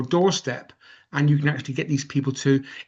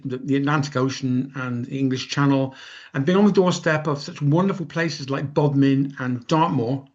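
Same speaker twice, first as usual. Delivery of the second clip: ordinary delivery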